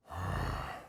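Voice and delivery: long tired sigh out; sigh, fed up with everything; weary sigh. Acted. Weary at week in jail.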